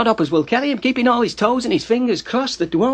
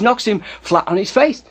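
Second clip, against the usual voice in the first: ordinary delivery, nothing marked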